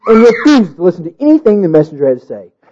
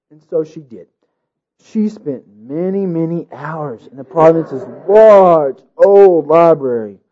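plain reading voice

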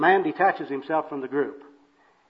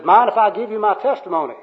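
loud, high, hammed-up offer; acted pushy soul-winner; overeager evangelist; 6 semitones higher, 7 dB louder than usual. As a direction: offer it with booming pious zeal. Third worker accosts the bum.

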